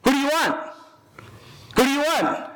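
high, pressing question to the crowd; Pilate demanding. Acted. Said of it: "So Pilate says".

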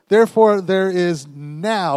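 loud "Therefore", then a long, drawn-out "now"; emphatic. Shown as a demonstration. Preacher showing congregation how to stamp on it, emphatic "now". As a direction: build slowly to a stretched "now". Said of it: "When you get emphatic".

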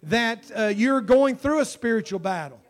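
loud, high, urgent preaching; impassioned altar call. Real, not acted. Preacher appealing to those in spiritual battle, urgently. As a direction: press the appeal home at full voice.

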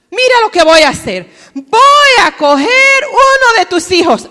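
amenaza a gritos, mucho más fuerte; grítale como un cobrador cruel; cobrador amenazante. Acted. (el cobrador amenaza a la viuda, furioso y gritando)

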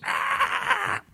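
high, strangled squawk of a shot chicken; high mock chicken shriek. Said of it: "and the chicken goes".

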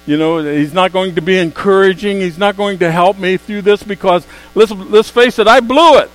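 loud, high, building preacher's cry; preaching at full force. Acted. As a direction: climb to a ringing shout. Preaching climax rising.

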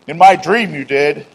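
loud, cross comeback; mock accusation. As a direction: play-act a petty grudge. Acted quarrel over a dream.

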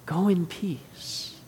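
softly spoken, gentle reassurance; tender, affectionate. Retold or imitated. Jesus speaks to the healed woman, tender and affectionate. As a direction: say it softly and tenderly, letting the end linger. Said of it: "in such a tender and affectionate way".

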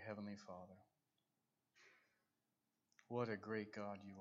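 soft murmured prayer, barely voiced; quiet prayer. Real, not acted. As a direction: pray softly, almost to yourself.

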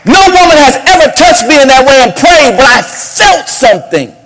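high, loud, proclaiming the climax; preaching at full force. Acted. Pastor telling the church about the prayer, full-force preaching. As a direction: shout the testimony to the church.

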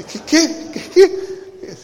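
high, laughing "qué, qué" of comic disbelief; mock disbelief. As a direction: play up your shock at the advice. Retold shock at father's advice. (incredulidad cómica, aguda y entre risas)

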